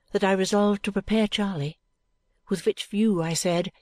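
plain reading voice

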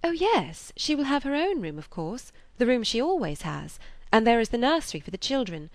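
a hint of a cheerful daughter; breezy reassuring daughter. A narrator's reading. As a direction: reassure papa with high, easy lifts. Voices Emma reassuring her father.

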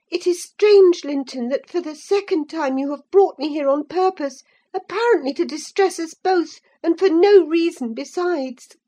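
high, loud indignant reproach; hurt and angry, demanding answers; angry cry. A narrator's reading. Catherine crying out at Linton in anger. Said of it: "cried Catherine".

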